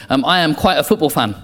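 ordinary delivery

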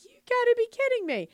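high, fast incredulous cry; can't believe she wants to walk. Retold or imitated. Telling friend she can't be serious, high incredulous.

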